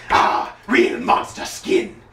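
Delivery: slow, rasping solemn villain voice; serious Lord Zed voice. Retold or imitated. Fan imitating silly Lord Zed, slow and rasping. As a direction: deliver a silly line with grave menace, slowly. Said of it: "that serious voice saying silly things like".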